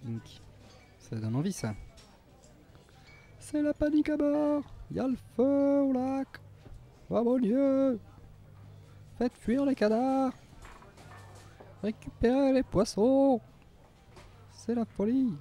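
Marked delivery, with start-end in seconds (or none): 3.4-15.3 much higher, slower hammed-up alarm voice; mock panic. Streamer joking on studio name, hammed-up high panic voice. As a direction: play a shrieking panicked announcer.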